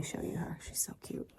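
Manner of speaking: soft whispered aside. Real, not acted. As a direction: whisper so the dog isn't disturbed. Whispering near sleeping dog.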